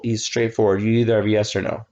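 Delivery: ordinary talk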